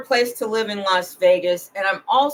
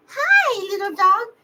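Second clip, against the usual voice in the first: much higher, louder sing-song greeting; people greeting the dog; 12 semitones higher, 5 dB louder than usual. Retold or imitated. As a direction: greet a little dog like a stranger on the street. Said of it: "they would say".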